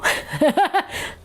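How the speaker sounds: short high laugh. Real, not acted. Host jokes to buyers about paying shipping, high laugh. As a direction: laugh at your own joke.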